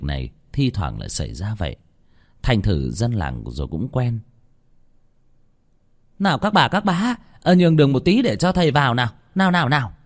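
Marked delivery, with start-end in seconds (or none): 6.2-9.9 much higher, fast, clearing the crowd; raised voice. Acted. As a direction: call over the crowd to make way. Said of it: "Bà Hương lớn giọng". (bà Hương lớn giọng dẹp đường cho thầy)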